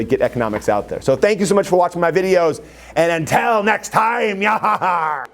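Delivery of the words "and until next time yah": drawn-out, raised farewell flourish; playful sign-off. Pretend. Teacher signing off to video viewers, playful flourish. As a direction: stretch out the goodbye theatrically.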